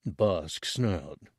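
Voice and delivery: ordinary delivery, nothing marked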